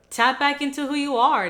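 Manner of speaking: rally them to tap back in; pep-talk shout to the viewers; emphatic call. Real, not acted.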